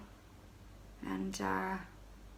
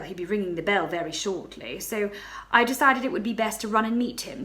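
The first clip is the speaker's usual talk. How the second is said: ordinary delivery, nothing marked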